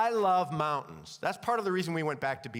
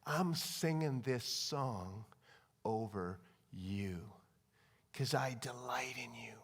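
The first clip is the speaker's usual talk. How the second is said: hushed, very slow, low voice; soft near-whisper with long pauses; the Lord speaking; 8 semitones lower, 8 dB quieter, slower than usual. Acted. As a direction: breathe it tenderly as God to a beloved child. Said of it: "I feel like the Lord says".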